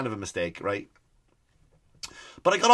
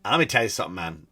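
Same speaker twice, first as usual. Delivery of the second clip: ordinary talk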